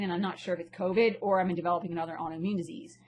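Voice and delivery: ordinary delivery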